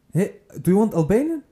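high bright mock question; imitating the stranger. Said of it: "Ich so". (spielt die fremde Frau, höher nach)

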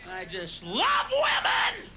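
stretched-out high cry of love; high slow declaration. Real, not acted. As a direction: proclaim it slowly to the room. Comic outburst to crowd.

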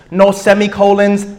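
ordinary talk

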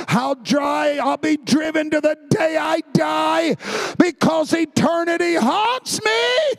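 fiery preacher calling people to the altar; preaching at full force. Acted. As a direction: preach it hard, building.

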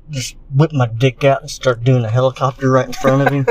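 ordinary talk

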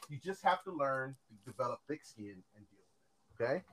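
very soft, low-key counsel; calm manager. Retold or imitated. Manager advising the teenage worker after the outburst, calm and hushed. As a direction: gently lay it out, voice dropped right down. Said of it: "he was so calm about it".

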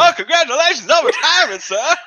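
put-on high, loud old-time congratulations; eager rookie. Rookie to retiring conductor, eager put-on voice. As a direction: gush loudly at the boss in character.

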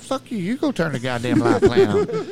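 high, heated retold refusal; indignant refusal. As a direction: throw the order back in mock outrage.

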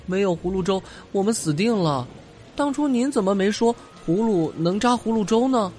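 high, lively little bunny; childlike high-pitched character voice; little rabbit voice. Acted. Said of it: "小白兔说道". (高声的小白兔声音)

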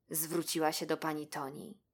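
usual speaking voice

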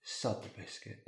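hushed and eager to get her to bed; seductive whisper. Acted. Husband urging wife to bed.

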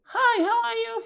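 put-on very high, sugary girlish voice; mock sweet date voice. To a date, the wife fakes a sweet high voice. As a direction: put on a syrupy sweet hello. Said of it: "women, they fake it".

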